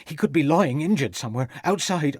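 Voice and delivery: tearful, building. A narrator's reading. Cook fearing for the young master, rising tearful and slowing. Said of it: "giving a loud sob".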